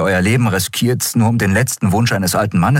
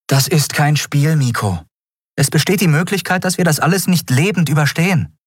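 higher, earnest warning voice; serious captain; 10 semitones higher than usual. Acted. Captain warning Miko it is no game, earnest voice. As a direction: make him feel the danger.

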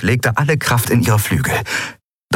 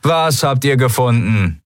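irritated, raised-pitch shout back; testy higher-pitched question; annoyed call; 5 semitones higher than usual. A narrator's reading. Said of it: "rief jemand genervt".

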